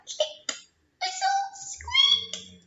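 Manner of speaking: squeaky high dolphin whistle and squeak; a very high, slow dolphin call; dolphin sounds. Shown as a demonstration. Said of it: "That's how they sound".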